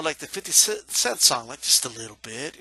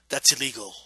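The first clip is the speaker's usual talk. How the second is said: ordinary talk, nothing marked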